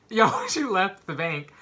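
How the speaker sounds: laughing in disbelief. Real, not acted. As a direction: laugh at the absurd news. Laughs at mom leaving car.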